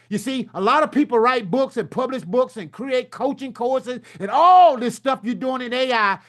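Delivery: usual speaking voice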